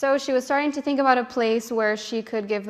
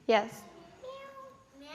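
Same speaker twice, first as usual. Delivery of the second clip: usual speaking voice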